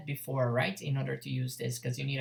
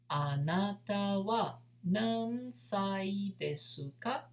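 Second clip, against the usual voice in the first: slow demo; 3 semitones higher, slower than usual. Shown as a demonstration.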